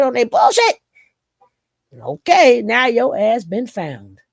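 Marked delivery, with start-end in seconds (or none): none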